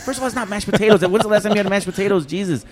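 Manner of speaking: high, loud indignant protest; worked-up retold rant; exasperated retort. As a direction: argue back in mock disbelief. Retells arguing with the diner.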